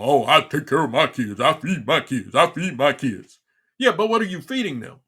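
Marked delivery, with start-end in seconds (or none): 0.0-3.4 mock mother bragging. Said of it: "And then talk about".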